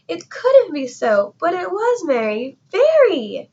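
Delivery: can't believe the merry sound; climbing to a high, loud "very"; astonished disbelief. A narrator's reading. Grinch hears merry singing.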